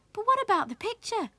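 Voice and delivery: put-on high-pitched pleading boy; lifted, protesting character voice; young Willie's voice. A narrator's reading. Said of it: "said Willie".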